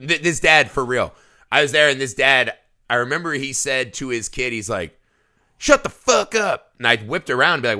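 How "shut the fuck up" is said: drawn-out, high-pitched imitation of a yelling father; retold yell without much extra volume; mock dad's yell. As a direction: imitate an aggressive dad yelling, slowly and pitched up. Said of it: "he was yelling at".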